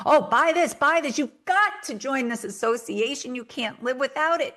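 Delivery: hammed-up pushy marketer pushing a sign-up; pushy salesy pitch. Marketer pressuring the audience to buy, mock hard sell. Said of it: "You're not like".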